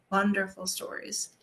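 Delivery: plain reading voice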